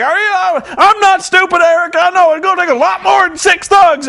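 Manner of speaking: high, loud mock shout; indignant wrestler. Jeff Jarrett defying Eric Bischoff, high hammed-up shout. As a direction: ham up an outraged veteran's protest.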